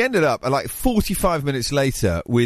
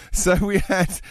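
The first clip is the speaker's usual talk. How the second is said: ordinary talk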